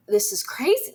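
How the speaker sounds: imitating her stunned reaction to the confession; shocked disbelief. Girlfriend reacting in stunned disbelief, high-pitched. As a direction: voice leaps up high on "crazy" in shock. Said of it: "she also was like".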